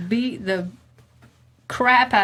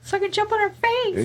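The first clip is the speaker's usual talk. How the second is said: very high, rapid squeaky put-on voice; Mara's voice; 14 semitones higher than usual. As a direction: voice Mara in a tiny high pitch. Voicing Mara, expected to attack.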